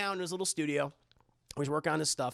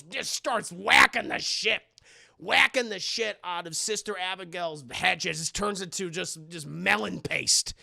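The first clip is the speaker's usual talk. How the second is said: normal talking voice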